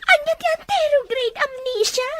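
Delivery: high, loud alarmed question; shocked question. Acted. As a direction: blurt it out in disbelief. Wife hears shocking diagnosis.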